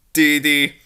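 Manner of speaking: usual speaking voice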